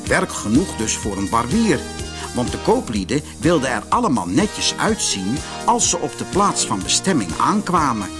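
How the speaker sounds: ordinary delivery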